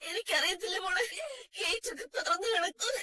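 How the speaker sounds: soft, tearful protest; thin crying plea; weeping helplessly. Acted. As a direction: sob through the words, lost for answers. Grandmother blamed for lost child.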